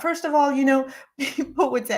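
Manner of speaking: ordinary delivery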